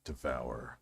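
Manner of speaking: deep, low "Devour."; deep-voiced catchphrase. Shown as a demonstration. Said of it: "deep like this".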